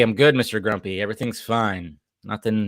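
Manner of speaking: ordinary delivery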